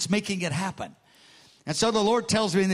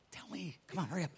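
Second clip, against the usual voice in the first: hushed impatient urging; 15 dB quieter, faster than usual. Retold or imitated. Pressing Bob, unsettled.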